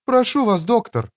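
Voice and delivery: high-pitched woman's reproach; reproachful. Acted. Элина reproaches the doctor, high-pitched. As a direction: gently scold in a high female voice. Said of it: "укоризненно проговорила Элина".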